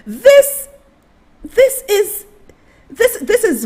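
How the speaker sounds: high, loud and stammering through a laugh; disbelieving laugh. Real, not acted. Vlogger laughing at the photo for viewers, stammering in disbelief.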